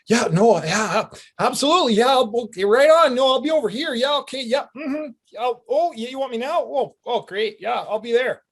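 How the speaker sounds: very high, eager yes-man babble; apologetic newbie agreeing with everyone; overly polite Canadian. Retold or imitated. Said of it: "Canadian attitude".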